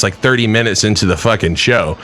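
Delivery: ordinary talk, nothing marked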